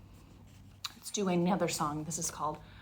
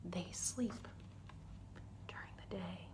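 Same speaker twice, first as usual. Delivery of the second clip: whisper; 3 semitones lower, 17 dB quieter than usual. Shown as a demonstration. Storytime whisper about sleeping.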